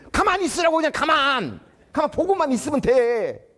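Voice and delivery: put-on a senior teacher angrily scolding the young teacher who blocked the gate; angry scolding. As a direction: snap at him to leave the kids alone. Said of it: "화를 내는 거예요".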